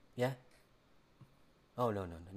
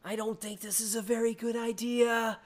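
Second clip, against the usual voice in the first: high, loud worried voice; nervous Fred voice; 14 semitones higher, 8 dB louder than usual. A narrator's reading. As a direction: squeak it out nervously. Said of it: "you hear him say".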